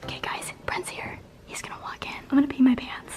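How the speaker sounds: hushed whisper before a surprise; excited whisper while hiding from the birthday guy. Real, not acted. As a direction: whisper so the guest can't hear.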